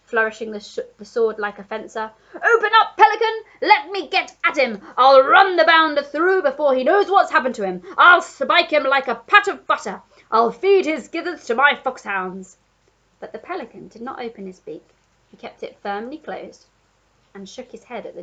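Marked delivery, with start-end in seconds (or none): 2.4-12.6 put-on loud, higher blustering old Duke threats; Duke shouting. A narrator's reading. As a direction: bellow it like a fired-up colonel. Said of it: "he shouted".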